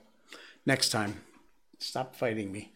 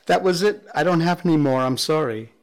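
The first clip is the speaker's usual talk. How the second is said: loud, raised-pitch pet talk; telling the dog firmly; 4 semitones higher, 6 dB louder than usual. Real, not acted. Host tells the dog cookies are finished, loud and high. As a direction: announce it loudly to an eager dog.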